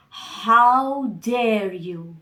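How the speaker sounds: measured fury held in check; angry father. Acted. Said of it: "he was angry at his children".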